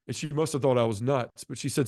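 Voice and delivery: usual speaking voice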